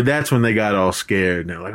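ordinary delivery

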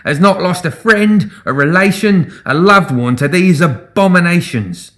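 high, loud rabble-rousing appeal; impassioned address to a crowd; demanding. A narrator's reading. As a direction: build the list slowly for the crowd. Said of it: "he demanded, turning to address the crowd".

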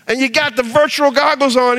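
normal talking voice

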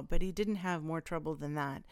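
ordinary delivery

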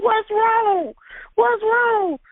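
high, desperate repeat; pleading for an answer; panicked plea. Retold or imitated. Mother asking officers in panic, high and pleading.

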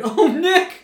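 high, loud, hammed-up cry of alarm; mock alarmed shout. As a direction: yell his name in mock panic. Acting alarm at fake aneurysm.

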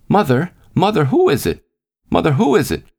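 put-on high, shrill little girl's call. A narrator's reading. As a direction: call down to mother from upstairs in a child's voice. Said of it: "the girl's voice upstairs calling shrilly".

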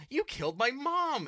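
high, loud imitated accusation; hammed-up furious cry; mock angry outburst. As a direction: yell it as the outraged son. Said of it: "he gets really angry at him".

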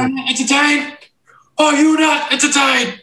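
high, loud Gladiator shout; Russell Crowe Gladiator impression. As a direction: bellow it like a gladiator to the arena crowd. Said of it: "Like, Russell Crowe".

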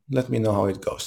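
usual speaking voice

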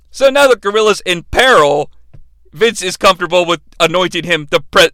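normal talking voice

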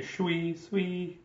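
high, soft mock-French voice; super French accent. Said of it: "it was, like, super French".

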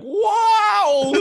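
high, loud elf "Wow". Retold or imitated. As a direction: yelp it like a giddy elf. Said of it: "your elf voice is when you do the kinda".

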